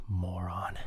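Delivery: low, whispered grumble; muttered insult. Acted. Host alone after a stupid call, muttering contemptuously. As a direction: mutter it under your breath.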